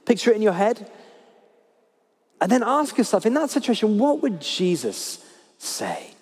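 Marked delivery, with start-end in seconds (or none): 4.0-6.1 drawn out, softer, each word weighed; slow emphatic question. Acted. Preacher asks the congregation, slow and soft. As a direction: ask it slowly and let it sink in.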